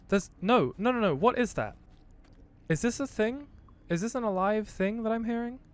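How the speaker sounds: spooked, asking what that noise is; alarmed. Real, not acted. Alarmed by a strange living sound.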